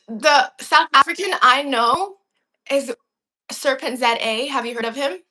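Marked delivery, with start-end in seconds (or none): none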